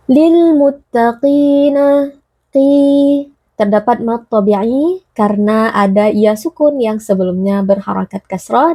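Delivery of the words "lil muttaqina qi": "Lil muttaqina, qi" chanted slowly with a long held qi; lengthened vowel. Shown as a demonstration. Teacher demonstrates mad on "qi", chanting the vowel long. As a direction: stretch the i of "qi" two beats, higher chant. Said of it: "dibaca panjang dua harakat".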